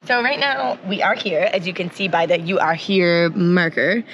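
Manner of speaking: ordinary talk, nothing marked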